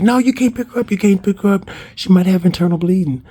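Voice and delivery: imitated urgent warning. Bystander stopping her from lifting injured sister, imitated urgent warning. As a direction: act out stopping someone in alarm.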